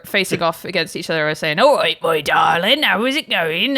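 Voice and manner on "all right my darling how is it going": slow, lightly voiced West Country greeting; a hint of a West Country farmer; West Country accent. Playing the British West Country host, light West Country accent. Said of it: "I'm British West country".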